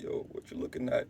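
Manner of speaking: a breathy, voiceless dare; hushed mock stare-down line; whispered challenge. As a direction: whisper the taunt under the breath. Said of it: "I'm like".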